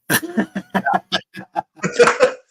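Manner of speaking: loud, real burst of laughter; amused laugh. Real, not acted. Amused at bad plan result.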